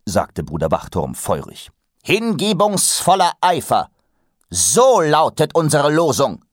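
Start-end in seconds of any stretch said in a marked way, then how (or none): 2.0-6.4 high, loud rallying cry; proclaiming call. A narrator's reading. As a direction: proclaim the motto with fervor. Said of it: "rief der oberste größte Meister".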